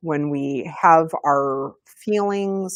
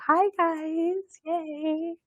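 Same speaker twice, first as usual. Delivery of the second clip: soft, very high cheery hello; high-pitched greeting; 14 semitones higher, 4 dB quieter, much slower than usual. Real, not acted. Speaker breaking off the talk to greet people, high and cheery.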